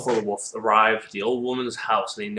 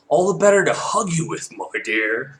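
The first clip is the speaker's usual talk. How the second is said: louder, higher put-on granny; wolf as grandmother; 5 semitones higher, 5 dB louder than usual. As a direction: play a fake sweet grandmother.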